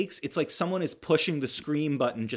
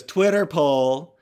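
loud, higher, long-drawn mock teen announcement; teen girl calling out; 4 semitones higher, 9 dB louder, much slower than usual. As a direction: blare it out slowly like a movie teen. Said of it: "she goes".

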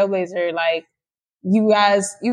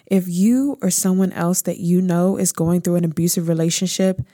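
smooth, measured ad read; promo voiceover; 3 semitones lower than usual. Acted. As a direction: read it like a heartfelt promo announcement. Promo voiceover introducing the guest.

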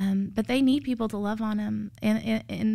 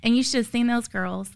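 usual speaking voice

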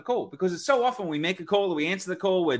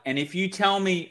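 ordinary talk

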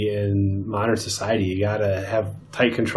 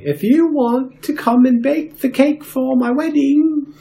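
high-pitched put-on duchess voice; Duchess of Montenaro; 13 semitones higher than usual. Duchess asks her look-alike to bake the wedding cake, high mock-duchess voice. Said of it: "she's like".

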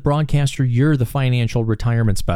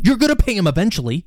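mock hard-sell, higher and quicker; pushy salesman close; 7 semitones higher, faster than usual. Host playing a blunt advisor pressing a client, mock hard-sell. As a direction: lean in and push the client to sign. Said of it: "look people in the face and lean over the desk".

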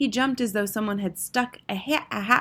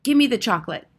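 loud, higher bratty shout; raised-voice boyish insistence; shouted demand; 5 semitones higher, 7 dB louder, faster than usual. A narrator's reading. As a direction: demand it like a suspicious boy. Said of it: "shouted Bruno, becoming suddenly suspicious".

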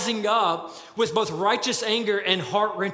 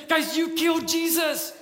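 higher, stricken accusation; anguished plea; 7 semitones higher than usual. Acted. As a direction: tell them what they did, heartbroken. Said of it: "He said".

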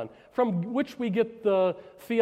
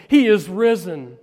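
louder, ringing out the Easter cry; shouted proclamation; 8 dB louder than usual. Retold or imitated. Preacher quoting a dying man's note, shouted proclamation. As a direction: proclaim it loudly from the quoted note. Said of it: "have no voice to shout".

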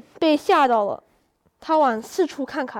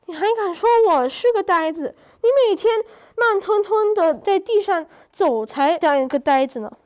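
rising, louder indignant comeback; indignant retort; 5 semitones higher, 4 dB louder than usual. A narrator's reading. Rabbit fires back at the turtle, raised indignant voice. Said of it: "笨笨忍不住了".

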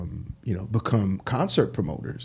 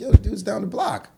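high, louder retold protest; incredulous objection; 11 semitones higher, 5 dB louder, faster than usual. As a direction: push back at partners in disbelief. Retold objection to his partners.

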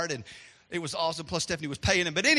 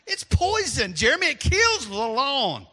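exasperated scolding; 5 semitones higher, 4 dB louder, slower than usual. Retold or imitated. Scolding retold to son.

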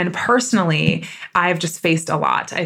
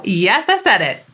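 ordinary delivery

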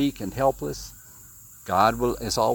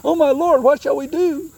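panicked servant; 13 semitones higher, 5 dB louder than usual. Retold or imitated. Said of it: "That's what he says".

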